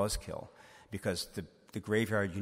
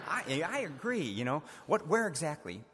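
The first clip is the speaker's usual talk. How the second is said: gentle coaxing; 8 semitones higher than usual. Pretend. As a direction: draw it out kindly. Gently questioning the story.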